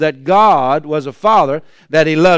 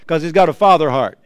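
ordinary delivery

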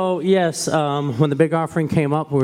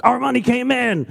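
put-on excited kids; 11 semitones higher than usual. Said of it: "And they're just like".

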